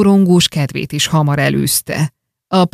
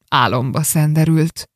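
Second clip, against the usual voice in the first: usual speaking voice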